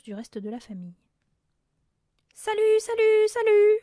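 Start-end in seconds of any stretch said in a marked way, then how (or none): 2.3-3.8 very loud, high parrot squawk; shrieking parrot. A narrator's reading. Hidden parrot calling to the armed family, shrieking. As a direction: blare it out like a parrot. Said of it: "lança de nouveau la voix".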